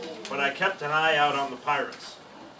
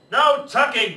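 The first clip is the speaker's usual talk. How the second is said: loud, higher crew outcry; 8 semitones higher, 7 dB louder, slower than usual. Acted. Pirate crew refuses to tuck the boy in, loud outcry. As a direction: cry it out together. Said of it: "the crew cried".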